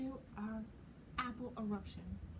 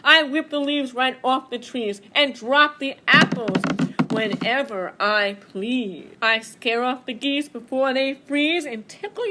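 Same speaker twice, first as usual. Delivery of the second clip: loud, booming boast of the wind; loud, proud wind shouting; mighty shout; 2 semitones higher, 7 dB louder than usual. Acted. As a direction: proclaim it all to the woods. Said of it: "mighty shout".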